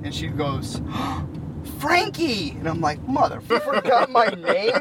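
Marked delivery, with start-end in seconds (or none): 0.9-1.8 imitated gasp of recognition; sharp mock gasp of surprise; startled gasp. Ex spots him at the mall, acted startled gasp. 1.8-2.5 high mock cry of recognition; surprised greeting. Ex spots him at the mall, high surprised greeting. 3.1-4.7 mock indignation. Ex got his name wrong, mock indignant reaction.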